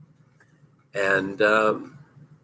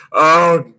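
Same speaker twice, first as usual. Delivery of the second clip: a hint of a higher, louder alarmed groan; mock groan; 7 semitones higher, 6 dB louder than usual. Skater squeezing between two blockers, mock alarmed groan. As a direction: toss off a mild alarmed "oh God". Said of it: "like that".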